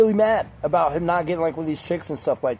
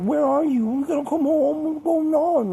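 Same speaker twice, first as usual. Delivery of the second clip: high-pitched mock nagging girlfriend; put-on high, pestering voice; clingy girlfriend; 11 semitones higher than usual. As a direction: imitate a needy girlfriend checking up, voice pitched way up. Imitates a clingy girlfriend calling.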